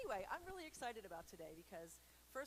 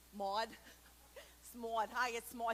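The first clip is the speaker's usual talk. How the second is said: louder, bright announcer-ish Maud greeting; Maud character voice; 2 semitones higher, 8 dB louder than usual. Pretend. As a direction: introduce yourself as Maud, louder. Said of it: "I feel like I should talk like this".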